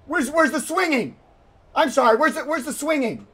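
high-pitched, baffled demand; exasperated and high; frantic bewilderment. Real, not acted. Frantic, cannot find the swing.

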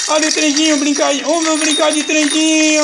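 put-on high, loud sing-song train call for the baby; high playful baby talk. Father calling his baby son to play train, high and playful. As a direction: squeal it up high like a toy train.

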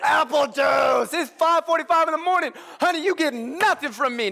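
dad hamming up a refusal; mock outburst. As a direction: snap back at the toddler in fake fury. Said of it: "She said it so hard".

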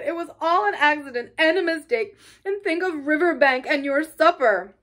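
high, loud, tumbling protest; distressed pleading. A narrator's reading. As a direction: pile up objections urgently, upset. Mole pleads tearfully after Rat.

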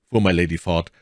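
plain reading voice

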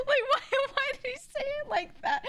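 very high, near-tearful laughing; helpless laughter. Real, not acted. Streamer asking why he said it like that, cracking up. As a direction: squeal it through the giggles.

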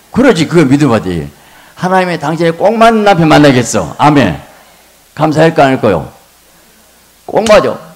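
loud, high fervent preaching; preaching at full force. Real, not acted. Preacher shouting to the congregation about husbands. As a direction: drive it home to the congregation.

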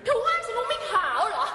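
shrill, mocking comeback, laughing through it; high-pitched catty villainess. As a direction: squeal the insult back, half laughing. Dubbing catfight villainess.

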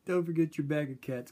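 ordinary talk, nothing marked

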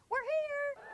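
high, loud playful holler; gleeful call. Retold or imitated. Kids call to strangers from the car window, high playful holler. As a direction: shout it out the car window like a kid. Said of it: "we'd hang out the windows and go".

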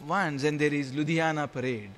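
ordinary delivery

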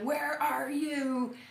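slow, booming voice of God; thundering God. Retold or imitated. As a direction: call it out like a god thundering from above. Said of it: "God's voice thunders again".

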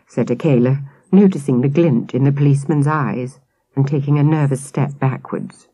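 ordinary delivery, nothing marked

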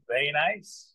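usual speaking voice

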